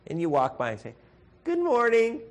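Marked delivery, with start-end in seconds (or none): 1.4-2.2 mock casual greeting. Passing casual greeting to burning house.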